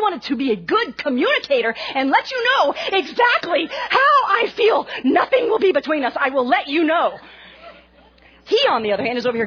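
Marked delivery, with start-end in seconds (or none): 2.1-7.2 rising mock yell. Wife pressing her silent husband in an argument, mock yelling. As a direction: voice climbs to a loud, high insistent shout. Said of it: "my voice would begin to elevate".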